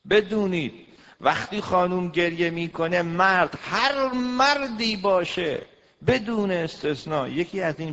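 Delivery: loud, high fiery sermon voice; preaching. Acted. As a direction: hammer the point home to the women. Preaching at full force.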